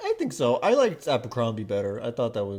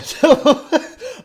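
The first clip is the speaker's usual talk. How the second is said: loud laugh; 13 dB louder than usual. Real, not acted. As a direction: burst of loud high laughter. Laughs at therapist interruption.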